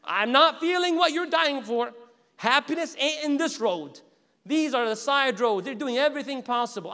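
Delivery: hammed-up call down from on high; mock yelling down. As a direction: shout back down the road as a warning. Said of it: "they're yelling down".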